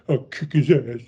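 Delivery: hammed-up grumbling imitation; mock sore-loser muttering; hammed-up mugging. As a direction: play it as mock mugging for the camera. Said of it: "totally mugging for the camera".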